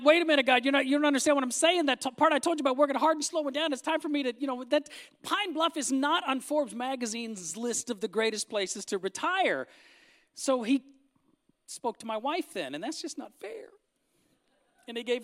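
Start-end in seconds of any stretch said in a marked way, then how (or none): 0.0-9.7 loud, fast, high protest. Retold or imitated. Preacher arguing with God about retiring, loud and indignant. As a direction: plead your case, indignant.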